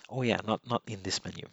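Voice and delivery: ordinary talk, nothing marked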